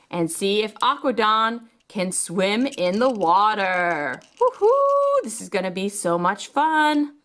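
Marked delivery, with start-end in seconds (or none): 4.4-5.2 high, bright woohoo; excited cheer. Pretend. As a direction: cheer for the toy. Toy cheering in play.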